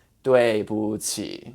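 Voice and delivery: 对不起 slow, 不 with no tone; natural neutral tone. Shown as a demonstration. Teacher shows the natural result, neutral tone. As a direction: let 不 in 对不起 fall flat. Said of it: "naturally becomes".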